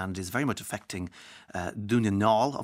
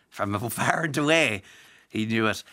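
mock Irish accent; 4 dB louder than usual. Mocking film title in stage Irish.

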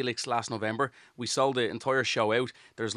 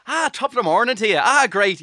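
higher, louder, hammed-up stage Irish accent; a jokey paddywhackery Irish lilt; 8 semitones higher, 8 dB louder than usual. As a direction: overplay the Irish accent for tourists in Spain. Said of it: "You literally go the opposite way".

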